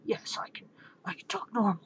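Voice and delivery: hushed, strained disguise voice; Batman. Acted. Masked hero explaining his odd sound, strained whisper. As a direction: whisper hoarsely to hide your identity. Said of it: "Can you talk normal?".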